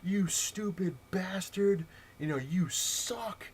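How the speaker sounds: high, slow strained imitation of a tantrum; mock screaming. Beaten kid screams on the microphone, mock strained rage. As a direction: draw it out like a scream held in. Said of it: "just screaming on this microphone".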